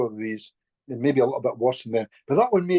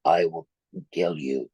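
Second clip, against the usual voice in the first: lower, menacing rough voice; the spirit speaks through her, lower and menacing; rough man's voice; 8 semitones lower, slower than usual. Retold or imitated. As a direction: drop down into a possessing spirit's threat. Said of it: "the voice opens and says".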